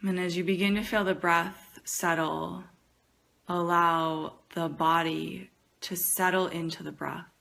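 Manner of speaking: soft, unhurried meditation voice with long pauses; guided meditation. Acted. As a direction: ease them gently into the breath.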